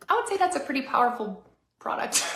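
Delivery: ordinary talk, nothing marked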